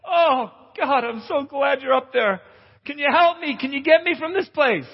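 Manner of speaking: high, grateful pleading voice; relieved pleading. Retold or imitated. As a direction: beg God to get you off the cliff. Man on cliff pleading.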